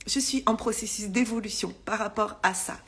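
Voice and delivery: whispered aside, almost to herself; sheepish whisper. Real, not acted. Admitting to viewers she's still working on it, in a whisper. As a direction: let it out as a guilty little secret, whispered. Confessing she may not manage it.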